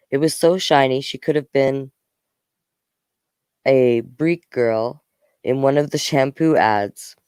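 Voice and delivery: plain reading voice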